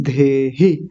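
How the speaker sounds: धेहि drawn out with a breathy dh; aspirated dh. Shown as a demonstration. Teacher showing students the word, धेहि slow and aspirated. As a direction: stretch धेहि slowly, aspirating the first sound. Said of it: "उसके बाद भी आप देहि बोलते हैं".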